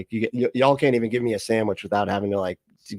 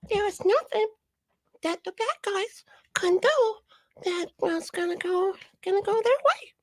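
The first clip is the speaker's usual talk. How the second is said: toddler playing prophecy; 3 dB quieter than usual. Host mocks the prophecy as toddler play, squeaky toddler voice. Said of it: "This is like a toddler doing, playing prophecy".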